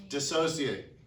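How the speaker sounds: ordinary talk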